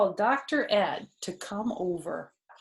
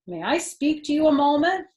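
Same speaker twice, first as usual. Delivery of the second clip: director's request; 7 semitones higher, 7 dB louder than usual. Acted. Band director addressing fox.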